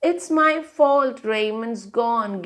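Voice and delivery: ordinary delivery, nothing marked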